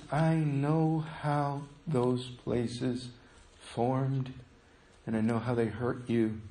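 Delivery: tender hush. Acted. Preacher voicing God to hurting listeners, hushed and tender. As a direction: voice God's comfort as a tender hush.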